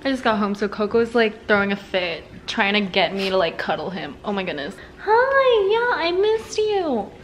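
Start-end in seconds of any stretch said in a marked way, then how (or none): 5.0-7.1 much higher cooing pet voice. Real, not acted. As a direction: coo at the dog, slowly. Talking to dog Coco.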